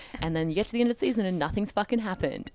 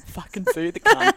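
loud, higher gleeful repeat; loud mock echo; 7 semitones higher, 8 dB louder than usual. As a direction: shout it back with glee. Echoing friend's line for laughs.

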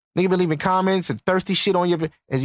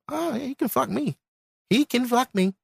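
mock girly voice; 5 semitones higher than usual. Mocking women who fall for him, high and breathy. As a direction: mimic her eager yes. Mocking a woman agreeing eagerly.

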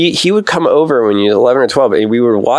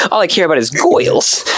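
higher-pitched mock Cagney accent; 5 semitones higher than usual. Kid buddy on about girls, imitated Cagney accent. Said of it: "he would talk in this Cagney accent".

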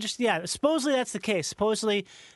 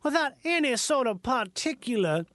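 put-on a lofty old Southern gentleman holding forth; refined Southerner; 3 semitones higher than usual. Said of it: "started off as the real".

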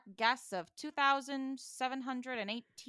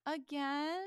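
put-on dejected kid; 6 semitones higher than usual. Gibby acted, dejected at testing.

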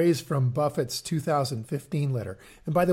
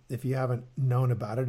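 ordinary talk

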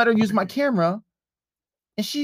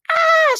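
drawn-out, high mock scream; imitated scream; 6 dB louder than usual. Imitating ex screaming outside mom's house, mock scream. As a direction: let out a long imitation shriek. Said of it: "she starts screaming".

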